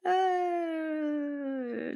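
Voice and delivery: a high, quiet, squealing giggle; high squeaky laugh. Real, not acted. Streamer cracks up at chat, high squeaky giggle. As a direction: let a thin squeal of laughter escape. Reacting to raccoon photo suggestion.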